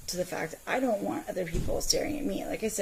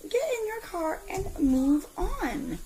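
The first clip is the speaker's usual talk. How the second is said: higher, slow, emphatic command; retold snap; 11 semitones higher, 4 dB louder, slower than usual. Mom retelling ordering the staring man to leave, stern. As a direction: order him off firmly. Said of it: "I'm like".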